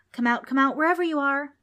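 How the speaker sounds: high, loud teasing call; sing-song calling. A narrator's reading. Female demon seeks the children, calling out. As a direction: coax them out of hiding.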